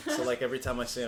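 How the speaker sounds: usual speaking voice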